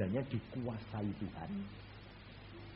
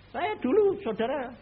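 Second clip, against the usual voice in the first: excited; 11 semitones higher, 7 dB louder than usual. Acted. Preacher's excited opening.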